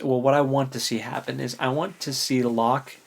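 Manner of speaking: usual speaking voice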